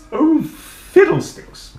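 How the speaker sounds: high, loud, slow exclamation; pooh-pooh it grandly; grand teacher. Acted. Teacher dismisses the problem, slow and grand.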